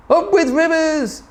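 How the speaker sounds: high hooted cheer; hooting. A narrator's reading. Duckbills hooting with delight, high cheer. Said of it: "And they hooted".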